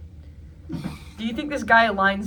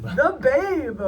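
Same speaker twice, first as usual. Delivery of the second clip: Babe Ruth voice; 7 semitones higher, 4 dB louder than usual. Pretend. Echoing the Babe sign-off.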